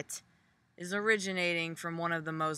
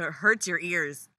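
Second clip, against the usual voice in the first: usual speaking voice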